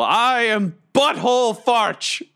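high, slow heroic proclamation; booming mock-heroic introduction at a high pitch; hammed-up yell. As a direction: announce yourself grandly, drawing the words out. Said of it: "And I yell".

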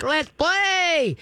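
high, playful squeal of a dog's mind; excited squeal. Retold or imitated. Dog trainer voices a dog's excitement, high playful squeal. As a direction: squeal like a dog ready to play. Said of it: "that just feeds the frenzy".

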